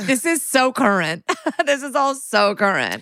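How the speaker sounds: laughing through the words. Real, not acted. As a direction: giggle while you say it, amused. Cohost laughing at absurd plot.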